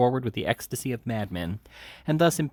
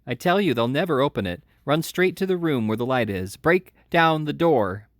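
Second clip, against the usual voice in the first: louder, higher urgent shouts; urgent shouting; 5 semitones higher, 4 dB louder than usual. A narrator's reading. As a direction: shout directions to the crowd. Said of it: "screamed the boy".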